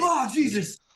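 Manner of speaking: loud jump of fright; startled. Acted. Lookout as a stranger appears beside him, startled. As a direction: yelp as if someone just popped up beside you.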